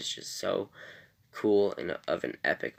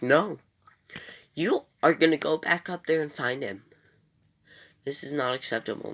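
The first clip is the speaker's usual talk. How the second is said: higher, louder indignant insistence; insistent demand; 6 semitones higher, 4 dB louder than usual. Retold or imitated. Lois ordering Superman back up to find their son, indignant demand. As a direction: refuse to accept it, pushing hard.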